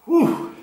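high blown-out whew from chili burn; sharp pained whew. Real, not acted. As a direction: blow out the heat with a loud whew. Exhaling after eating hot pepper.

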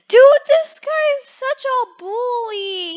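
high, loud, drawn-out complaint; mock indignation. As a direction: whine loudly about the bully. Mock outrage at bully.

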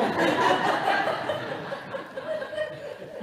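a burst of high, airless laughter; high breathy laugh. Real, not acted. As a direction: laugh out at the joke, breath only. Laughs after ugliest thing line.

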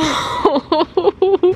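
high squeal of a laugh; a shrill burst of laughter. Real, not acted. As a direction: laugh it off in disbelief. Laughs at sold out shelf.

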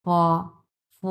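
ফ as a long "fwo" with air from the mouth; airy f sound. Shown as a demonstration. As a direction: blow air through ফ, as in ফড়িং. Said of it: "মুখ দিয়ে বাতাস বের হবে".

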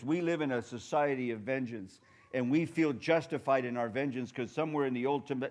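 ordinary talk, nothing marked

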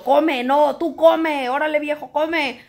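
imitating a nagging wife telling her husband to eat; mock pushy coaxing. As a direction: push him to eat like a nagging mother. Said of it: "yo no puedo forzarlo".

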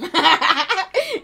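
a real burst of loud laughter; sudden loud laugh at the chat. Real, not acted. Reacting to a funny comment.

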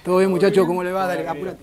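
usual speaking voice

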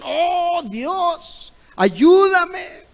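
high, loud imitated cry to God; desperate cry for help. Preacher re-enacting the woman's desperate cry to God. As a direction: plead for help like a woman at breaking point. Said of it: "Es un grito de desesperación".

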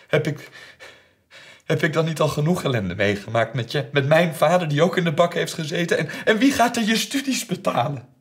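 high, loud, escalating rant; furious outburst. Retold or imitated. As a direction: build to a shouted challenge. Furious outburst in family quarrel. (woedend, steeds hoger uitvarend)

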